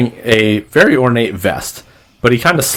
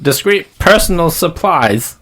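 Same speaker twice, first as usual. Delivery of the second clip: very high-pitched, weird official voice; strange, high gatekeeper voice; weird attendant; 8 semitones higher than usual. Acted. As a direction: pipe up high like an odd dockside attendant. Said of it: "they sound weird".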